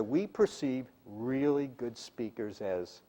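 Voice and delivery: slow, soft build that halts after "as"; pausing for emphasis. Shown as a demonstration. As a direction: draw out "really good speakers as", then hang before the key word. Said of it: "the pause lets you give emphasis".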